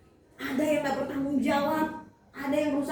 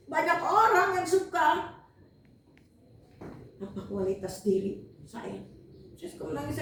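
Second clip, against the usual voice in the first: usual speaking voice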